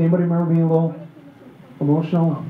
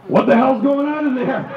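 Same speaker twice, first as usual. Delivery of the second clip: higher demanding holler from outside; put-on exasperated wife, higher-pitched; mock wife voice; 4 semitones higher, 4 dB louder than usual. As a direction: caricature an impatient wife calling in. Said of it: "That's not how she talks".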